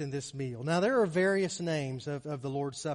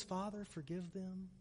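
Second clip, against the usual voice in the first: very soft, hushed plea; Jesus; 3 semitones lower, 14 dB quieter than usual. Acted. Preacher voicing the prayer of forgiveness, hushed. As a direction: quote it low, almost under your breath. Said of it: "can you hear his voice that says".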